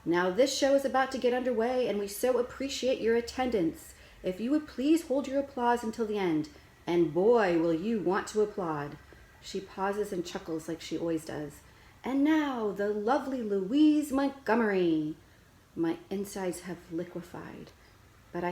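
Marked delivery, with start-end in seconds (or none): 0.1-9.0 loud, high ringmaster announcer voice; showman voice. A narrator's reading. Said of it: "the voice she saves especially for shows". 12.1-15.2 loud, high ringmaster announcer voice; showman voice. A narrator's reading. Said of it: "the voice she saves especially for shows".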